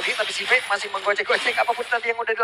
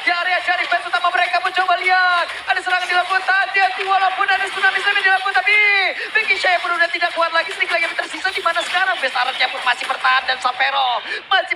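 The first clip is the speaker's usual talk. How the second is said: loud hyped play-by-play; esports caster; 8 semitones higher, 4 dB louder than usual. Acted. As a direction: call the teamfight with hype. Hyped teamfight call.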